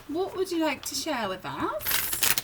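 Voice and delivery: a high, put-on British accent asking Spirit; a playful attempt at a British accent. Reader asks Spirit in a mock British accent. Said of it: "My British accent, you know, it's not the best".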